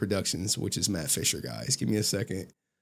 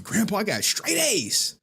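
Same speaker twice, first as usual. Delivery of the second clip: retold proud announcement, pitched up; eager, higher-voiced mock boast; excited grandkid; 10 semitones higher than usual. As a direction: play the hopeful kid angling for a reward. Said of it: "came home from college like".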